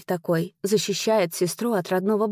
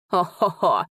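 acted, bitter ho-ho-ho; bitter laugh. A narrator's reading. Dzani mocks the cavalier's question, bitter laugh. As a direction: laugh it out in character, without mirth. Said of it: "рассмеялся Дзани смехом высоким и полным горечи".

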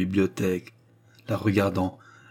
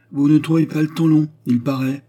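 louder, higher ironic jab; 7 semitones higher, 6 dB louder than usual. A narrator's reading. Clotilde mocking the young couple, ironic. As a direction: needle them with irony. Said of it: "observa ironiquement madame Clotilde".

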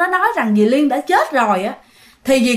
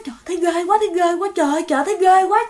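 retold fright; 4 semitones higher, faster than usual. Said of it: "dì Cúc sợ".

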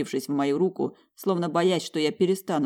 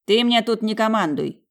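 higher, louder stern rebuke; 7 semitones higher, 6 dB louder than usual. A narrator's reading. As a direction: put him in his place. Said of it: "поставил он меня на место".

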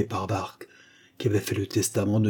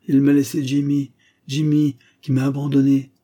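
higher, louder bitter grief; anguished lament; 5 semitones higher, 7 dB louder than usual. A narrator's reading. Old father lamenting his absent son, loud bitter lament. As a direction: cry out over being abandoned.

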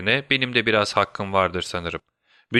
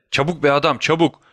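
higher-pitched, impatient urging; urgent hurrying; 4 semitones higher, 3 dB louder than usual. A narrator's reading. Holmes hurries Watson in a life-or-death matter, urgent. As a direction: hustle a companion along.

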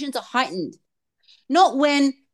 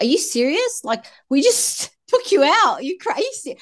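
retelling her own irritated snap, higher; huffy disbelief at the child, pitched up; annoyed outburst; 6 semitones higher than usual. Retold or imitated. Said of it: "I was really annoyed".

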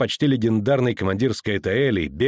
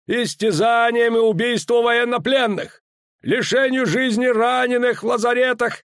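high, louder official decree; stern commander; 13 semitones higher, 4 dB louder than usual. A narrator's reading. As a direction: read out the charges sternly. Danza reading terms. (суровое оглашение)

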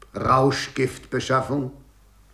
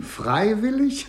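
high, slow scoffing disbelief; incredulous; 10 semitones higher, slower than usual. Acted. Lagrange scoffing at the prisoner's claim, high incredulous echo. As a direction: echo the word back as if it were absurd.

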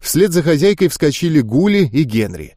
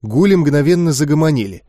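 ordinary delivery

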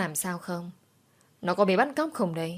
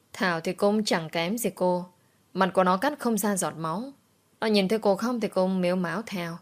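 ordinary delivery

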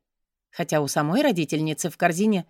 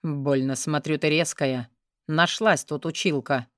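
low, slow mocking man's voice; cold condescension, low and slow; low metallic voice; 4 semitones lower than usual. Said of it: "становится ниже и приобретает металлический оттенок".

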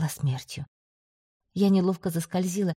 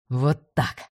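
triumphant exclaim; 4 semitones lower than usual. A narrator's reading. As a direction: lower voice, triumphant shout. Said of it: "победно воскликнул командор".

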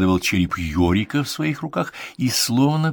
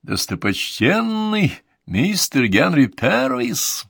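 grand announcer; 8 semitones higher, slower than usual. Acted. Announcer introducing at music hall.

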